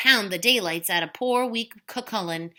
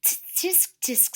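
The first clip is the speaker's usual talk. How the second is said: tut tut; 9 semitones higher, 6 dB quieter than usual. Acted. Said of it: "said Una".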